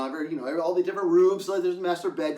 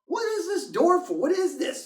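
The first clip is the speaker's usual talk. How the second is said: puzzled; 11 semitones higher than usual. Retold or imitated. Curious at a strange door.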